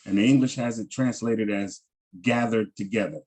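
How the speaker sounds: usual speaking voice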